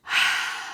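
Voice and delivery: long audible breath out through the mouth; big sigh. Shown as a demonstration. Teacher shows the children a calming big sigh. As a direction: let it all go in one sigh. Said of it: "like a big sigh".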